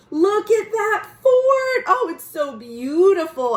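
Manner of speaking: high, loud cry of delight. Acted. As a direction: gush over the fort like a thrilled girl. Ruby delighted at fort.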